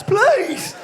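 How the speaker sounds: high, loud pleading squeak; voice shooting up. Acted. Lad begging in bed, voice shooting up like a child's. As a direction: plead with the voice leaping up by accident. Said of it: "Like you're about six or something".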